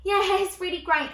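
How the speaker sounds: high, loud polite enthusiasm; over-keen small talk. Retold or imitated. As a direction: overdo the keenness for an acquaintance.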